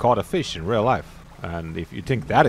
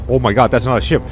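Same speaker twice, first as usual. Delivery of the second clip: loud alarm at usual pitch; alarmed realisation; 8 dB louder, much faster than usual. Real, not acted. Player spotting something that's not a ship, loud alarm.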